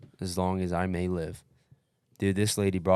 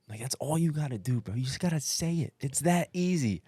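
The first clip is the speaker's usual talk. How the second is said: higher, coaxing devil-on-shoulder voice; tempting inner voice; 7 semitones higher than usual. Retold or imitated. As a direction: lure him like a tempter. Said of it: "it was like the angel and the devil".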